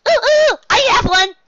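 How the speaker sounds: very high, loud eager squeal; bouncing excitement. A narrator's reading. Pinkie volunteering a song, bouncing and squealing. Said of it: "Pinkie Pie bounced up and down".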